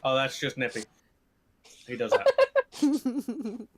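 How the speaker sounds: usual speaking voice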